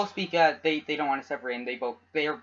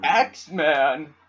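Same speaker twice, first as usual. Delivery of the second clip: goofy voice; 5 dB louder, much slower than usual. Acted. Echoing the word in funny voices.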